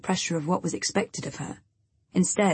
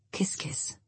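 soft unvoiced whisper; 9 dB quieter than usual. A narrator's reading. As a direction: breathe the kisses out. Signing off a letter.

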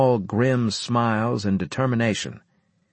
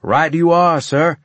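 high, ringing soldier's reply; loud and confident; 9 semitones higher, 7 dB louder than usual. A narrator's reading. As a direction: sound off to a superior without any doubt. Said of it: "I said loudly, without a trace of doubt".

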